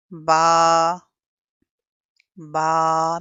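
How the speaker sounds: बा held about a second, strong and loud; stretched ba. Shown as a demonstration. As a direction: model बा drawn out one count. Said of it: "हम इसको stretch करेंगे".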